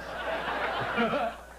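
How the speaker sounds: short breathy laugh while he waits; airy laugh. Real, not acted. Comic laughing while waiting for the crowd.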